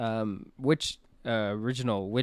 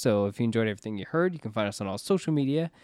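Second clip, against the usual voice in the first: podcast sign-off; 4 semitones higher, faster than usual. Acted. Host plugs the show to listeners, brisk sign-off.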